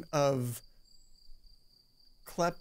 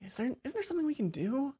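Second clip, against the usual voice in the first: Mai blaming herself; guilty Mai; 9 semitones higher, 5 dB quieter than usual. Acted. Mai asks.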